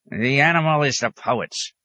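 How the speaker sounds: lightly voiced high hermit; a hint of cranky old man; grunting hermit. A narrator's reading. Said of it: "the hermit grunted".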